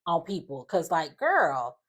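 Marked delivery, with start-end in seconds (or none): none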